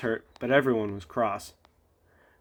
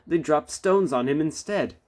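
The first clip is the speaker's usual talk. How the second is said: ordinary delivery, nothing marked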